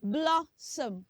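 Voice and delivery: "Blossom" with short open o, BLOSS-um; correct pronunciation. Shown as a demonstration. Teacher saying it for children to echo, correct "Blossom". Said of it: "Say after me".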